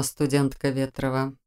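soft and slow, stress on студентка; softly. A narrator's reading. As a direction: gently say студентка Ветрова. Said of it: "мягко произнёс магистр".

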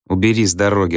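ordinary delivery, nothing marked

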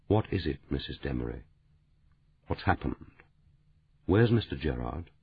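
low but clear. A narrator's reading. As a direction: keep it low and steady. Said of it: "James DeWitt's voice was low, but very clear".

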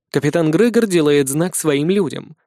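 usual speaking voice

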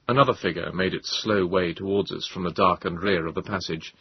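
ordinary delivery, nothing marked

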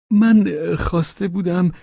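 high, halting, unfinished attempt; hesitant Pavel voice. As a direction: start high, falter and leave it hanging. Pavel tries again, breaks off. (با صدای زیر و مردد، ناتمام)

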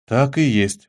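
ordinary delivery

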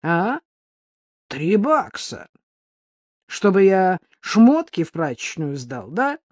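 loud, mocking menace; threatening. A narrator's reading. Mugger scorning a woman's few dollars, threatening. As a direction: throw the offer back with contempt. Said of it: "Голос стал ещё более угрожающим".

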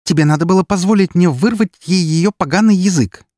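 high, loud angry grievance; spat displeasure. A narrator's reading. Эри reproaches the narrator for stopping her, spitting angrily. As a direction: spit it out venomously at high pitch. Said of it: "недовольно выплюнула Эри".

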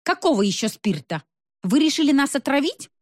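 indignant questioning, climbing high at the end; bristling indignation. A narrator's reading. Woman accusing man of poisoning them, mounting indignation. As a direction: challenge him, pitch rising into accusation. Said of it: "набычилась Роза Жановна".

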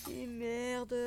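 juron aigu, à voix retenue; juron affolé. Acted. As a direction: jurer pour soi, affolé, plus haut et moins fort. Juron après le vase brisé. (juron de panique, haut perché)

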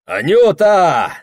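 high loud drawn-out tipsy call. Acted. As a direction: call out to her, a bit drunk. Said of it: "взывает захмелевший Прохор".

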